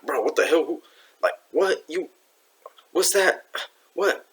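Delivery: mock panic of startled disciples; freaking out. Disciples seeing a figure on the sea, freaking out. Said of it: "there was freaking out".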